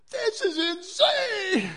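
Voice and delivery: slow, high, laughing disbelief; high laughing "this is insane"; stunned disbelief. Retold or imitated. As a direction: marvel at the madness with a laugh. Goat walks in, acted disbelief.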